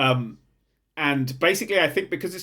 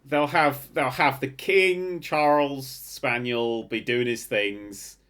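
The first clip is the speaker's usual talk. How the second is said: usual speaking voice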